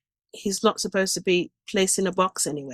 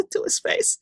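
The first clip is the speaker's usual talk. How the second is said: laughing disbelief; 11 semitones higher, 3 dB quieter than usual. Real, not acted. Laughs at family's plan.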